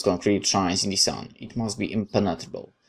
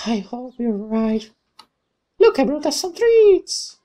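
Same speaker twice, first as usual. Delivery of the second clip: put-on much higher, bright girlish voice; cheerful young woman; 6 dB louder, slower than usual. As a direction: play the friendly girl who sneaks up, chirpy.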